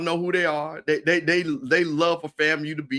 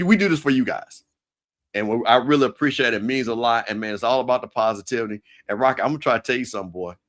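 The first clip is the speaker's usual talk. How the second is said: usual speaking voice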